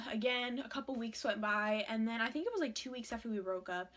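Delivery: ordinary talk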